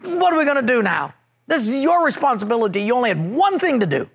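high-pitched, accusing, rushed rant; retold angry blame. Husband ranting at his wife by the car, high accusing anger. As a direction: pile the blame on her. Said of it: "I was so angry".

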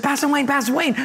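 high-pitched imitated greeting call; eager calling out. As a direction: call to the pastor as an excited acquaintance would. Woman calls out to pastor.

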